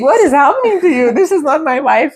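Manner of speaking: high, laughing mock husband disbelief; husband baffled. Husband baffled at his moody wife, high laughing disbelief. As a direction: play the stunned husband, half laughing. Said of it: "he was like".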